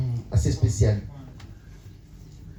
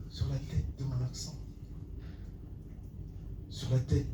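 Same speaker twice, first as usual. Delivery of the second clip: softer echo sinking in pitch; fading slam refrain; 4 semitones higher, 11 dB quieter than usual. Acted. Poet repeats his refrain about homeland, fading slam.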